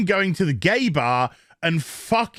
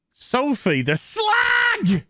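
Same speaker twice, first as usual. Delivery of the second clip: slow, high shouted title; mock herald shout; 10 semitones higher, much slower than usual. Herald shouting Sophie's title, slow and high. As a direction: bellow it like a herald.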